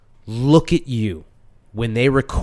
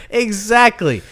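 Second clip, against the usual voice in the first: ordinary delivery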